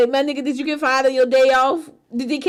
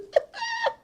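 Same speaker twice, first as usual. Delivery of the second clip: high laugh; 4 dB quieter than usual. Real, not acted. High laugh after thanks.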